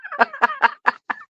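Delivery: very high rising shriek of laughter; piercing giggle that climbs; squealing laugh. Real, not acted. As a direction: squeal with delight. Reacting to shocking gossip.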